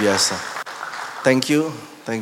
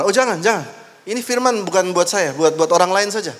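higher, brushing-off protest; mock angry refusal; 7 semitones higher than usual. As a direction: wave it away, half-annoyed. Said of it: "Dia marah".

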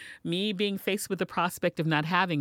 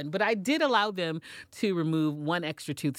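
ordinary delivery

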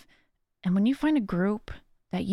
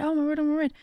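hyper excited; 5 semitones higher, faster than usual. Retold or imitated. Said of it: "I get super hyper".

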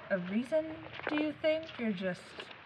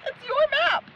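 much higher, louder mock disbelief; incredulous teasing; 14 semitones higher, 5 dB louder than usual. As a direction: rib him in disbelief.

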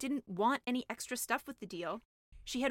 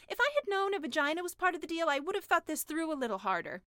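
higher, louder fretting mermaid; doubtful mermaid; 6 semitones higher, 3 dB louder than usual. Acted. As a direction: fret about the deal.

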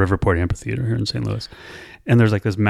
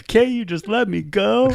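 put-on slow, much higher crying drunk plea; drunken pleading; 10 semitones higher, slower than usual. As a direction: beg the cops like a weepy drunk. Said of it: "And I love my girlfriend".

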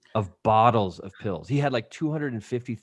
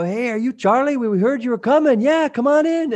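much higher, louder welcoming voices; cheerful greeting; 13 semitones higher, 8 dB louder than usual. Retold or imitated. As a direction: greet him brightly like old friends. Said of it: "And they all go".

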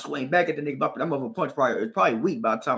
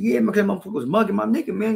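fast, higher and louder comeback; heated retort; 6 semitones higher, 3 dB louder, faster than usual. Retold or imitated. To the guy mugging his friend, a quick heated retort. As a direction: fire back fast at him. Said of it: "I said".

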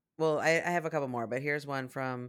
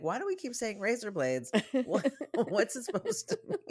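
a laughing, pitched-up retelling of her own confusion; laughing self-quote; 8 semitones higher than usual. Retold or imitated. Host laughing at her own lyric confusion, asking through laughter. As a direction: laugh through the puzzled question. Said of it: "'cause I kept saying".

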